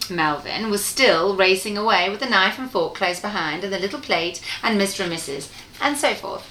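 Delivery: ordinary delivery, nothing marked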